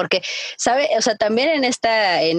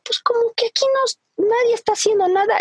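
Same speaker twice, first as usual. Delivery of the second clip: high put-on voice of a dad; lightly imitating the father, pitched way up; mimicking dad; 14 semitones higher, faster than usual. Dad voiced deciding to act.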